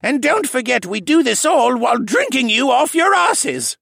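loud, high sung-out climax; rousing shout. Acted. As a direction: belt the punchline. Rousing climax of drunken verse.

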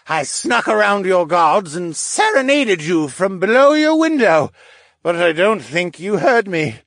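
high, loud, swooning pleading man; lovesick suitor. A narrator's reading. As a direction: plead with her, lovestruck and dramatic. Lovesick suitor's long plea.

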